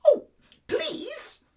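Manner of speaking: high, loud pleading squeak; little mouse squeaking for mercy; squeaky mouse. Acted. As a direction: beg like a tiny frightened mouse. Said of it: "squeaked Mouse".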